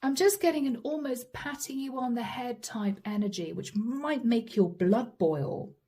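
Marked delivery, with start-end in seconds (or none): none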